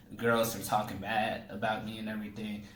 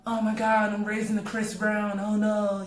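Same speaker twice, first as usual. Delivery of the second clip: high, loud panicked mom voice; mock a frantic mother, pitched up; distraught mom; 10 semitones higher, 4 dB louder than usual. Son tells about his mother panicking over his fighting, high mock wail. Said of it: "she's distraught and everything".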